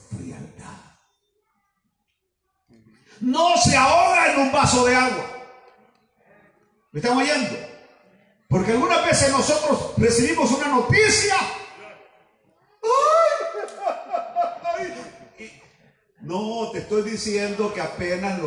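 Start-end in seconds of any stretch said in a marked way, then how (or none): 3.2-5.3 raised, louder preaching voice; loud emphatic sermon line; preaching shout. Acted. Preacher exhorting congregation. 12.8-14.9 mock panic. Mimics panicked person at news.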